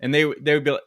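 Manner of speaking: usual speaking voice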